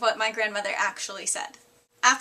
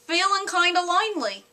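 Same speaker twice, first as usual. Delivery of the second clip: higher-pitched Australian accent; 5 semitones higher than usual. Shown as a demonstration. Vlogger shows off a second phrase in high-pitched Australian accent. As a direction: put on a high Aussie voice. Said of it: "say with an Australian accent".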